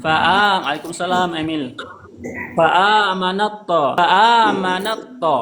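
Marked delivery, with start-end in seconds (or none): 2.6-5.4 "fa'amanat to" very slow, t folded into to; merged t into to. Shown as a demonstration. Teacher demonstrating idgham of ta into to, slow. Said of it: "digabungkan tak sama to".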